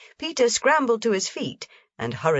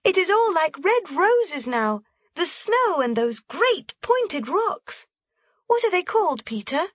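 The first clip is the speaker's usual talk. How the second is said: put-on high, wondering little girl; Heidi, awed; 13 semitones higher than usual. A narrator's reading. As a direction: marvel at the rosy peaks.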